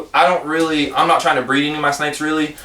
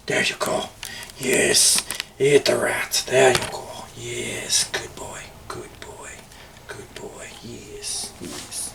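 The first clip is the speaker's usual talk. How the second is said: hushed praise; 3 semitones lower, 9 dB quieter, much slower than usual. Real, not acted. Owner praising his snake Kratos as it eats, whispering. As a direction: breathe the praise so you don't spook him.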